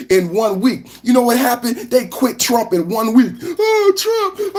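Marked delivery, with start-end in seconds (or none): 3.6-4.6 high whiny fake sob; mock crying. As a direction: whimper the name like a crybaby. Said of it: "I didn't start crying and say".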